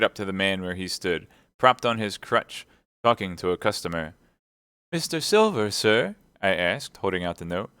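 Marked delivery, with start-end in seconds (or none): 4.9-6.2 lightly voiced boy, higher pitch; a hint of a boy's voice; young boy's question. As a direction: ask politely, pitched up like a lad. Boy nervously addresses a stranger.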